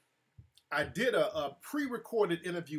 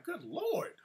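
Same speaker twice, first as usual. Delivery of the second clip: much higher, exasperated mock exclamation; high, incredulous aside to himself; good Lord; 12 semitones higher, 4 dB quieter than usual. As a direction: throw up your hands in disbelief. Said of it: "I'm like".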